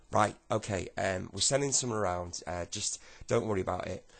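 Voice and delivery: loud, stumbling phone instructions; flustered official. Acted. Official telling the caller to adjourn the case, flustered.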